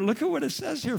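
ordinary talk, nothing marked

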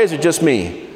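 normal talking voice

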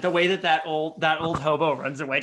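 usual speaking voice

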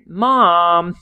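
loud, drawn-out whine to a parent; mock-exasperated kid calling out; whiny kid complaint. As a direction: whine it like a let-down child. Acted kid whining to mom.

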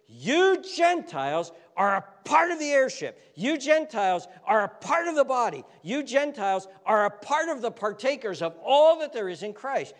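high, loud proclamation; fervent preaching. Acted. Preacher proclaiming to Gentile listeners that they belong, fervent and loud. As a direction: preach it out with rising fervor.

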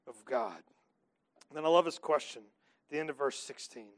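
ordinary delivery

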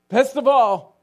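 the repeated words rise to a joyful shout; exultant cry. Retold or imitated. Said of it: "kept saying, repeating".

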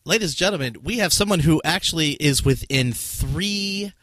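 showy host voice selling the prize; game show announcer. Acted. Announces the winner.

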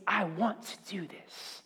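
slow hushed whisper of God's wish; an unvoiced, slowed intimate whisper; soft whisper. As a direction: breathe it as a tender secret. God's inner wish, voiced softly.

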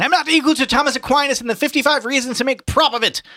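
high, loud theatrical wail; Buck Mulligan shouting in pain. A narrator's reading. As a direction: protest at full pitch, hammed-up suffering. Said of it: "Buck Mulligan shouted in pain".

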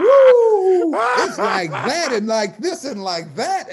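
high, loud whooping woo, then slow sing-song like that; mock wow reaction. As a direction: hype it up like a cartoon whistle of amazement. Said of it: "her ass is like".

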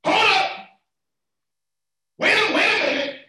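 high, loud preacher's halt; mock interruption. Preacher playfully halting his own sermon, calling out.

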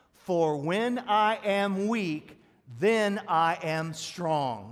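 loud, very slow, every word weighted; a slow declaration the whole church says back; loud slow call to repeat. Shown as a demonstration. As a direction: lead the congregation in saying it together. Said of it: "let's say it together".

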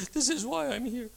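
high, whiny self-justifying; mock excuse. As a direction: play the petulant excuse.

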